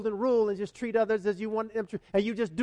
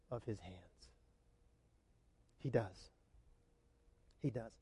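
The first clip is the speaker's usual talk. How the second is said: tender reassurance; 4 semitones lower, 8 dB quieter than usual. Real, not acted.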